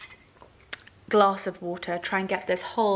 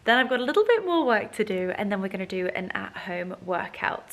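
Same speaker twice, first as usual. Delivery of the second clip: ordinary talk, nothing marked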